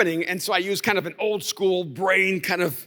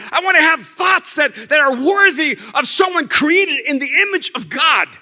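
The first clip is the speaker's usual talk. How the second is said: raised, loud, pleading cry to God; impassioned prayer; 8 semitones higher, 7 dB louder than usual. Retold or imitated. Preacher reliving his cry to God, loud and pleading. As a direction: call out to God with full force.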